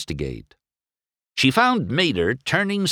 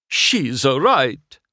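slow, breathy Italian admission; grudgingly stunned; surprised gasp; 6 semitones higher, 4 dB louder, slower than usual. A narrator's reading. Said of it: "he gasped".

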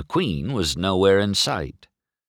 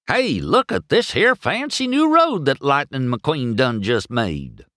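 excited hillbilly tow truck; 6 semitones higher than usual. A narrator's reading. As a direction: show off the new road proudly. Mater shows off the road.

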